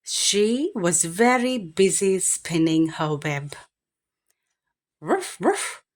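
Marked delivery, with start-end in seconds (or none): 5.0-5.8 high, yappy little barks; barking dog. Acted. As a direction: bark like a small eager dog. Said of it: "barked the dog".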